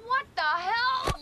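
slow, high, drawn-out outrage; outraged. Acted. Kat tricked with wet Kleenex, drawn-out outrage. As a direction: stretch each word in shocked anger.

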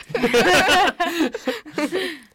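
big burst of laughter; loud laugh. Real, not acted. Group laughing at joke.

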